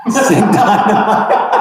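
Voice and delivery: helpless laughter breaking into speech; laughing. Real, not acted. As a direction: read it out while cracking up. Laughs at singing requests.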